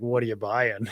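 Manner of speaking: Resident Evil merchant. Retold or imitated. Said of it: "I don't know if anyone's played Resident Evil four".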